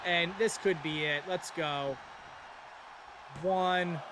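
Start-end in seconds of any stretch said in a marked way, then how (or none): 3.4-4.0 building count to three; wrestling commentator. Acted. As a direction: count the pin, rising to a yell.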